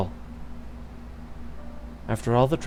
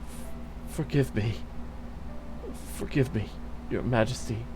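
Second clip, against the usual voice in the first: broken apology; 8 dB quieter than usual. Acted. Remorseful whispered apology.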